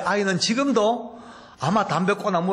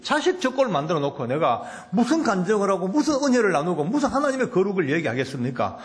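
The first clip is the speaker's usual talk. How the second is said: ordinary delivery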